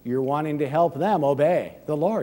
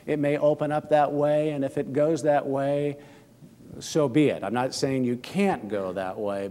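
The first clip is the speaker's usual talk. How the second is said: ordinary delivery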